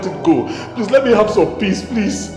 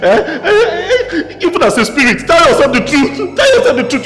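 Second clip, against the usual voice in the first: high, worked-up challenge; heated, stammering exclamation; agitated protest; 8 semitones higher than usual. Acted. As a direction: throw it back at the spirit, pitch raised.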